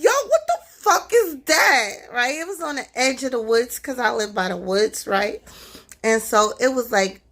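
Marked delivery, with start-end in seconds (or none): 0.0-1.9 much higher, louder, startled yelp at the eyes; startled cry. Retold or imitated. Storyteller reliving spotting eyes in the dark, startled higher yelp. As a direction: jump out of your skin in the retelling.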